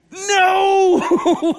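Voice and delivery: a loud, high, laughing "No!"; laughing shout. Acted. Preacher rejecting the idea to the congregation, laughing shout.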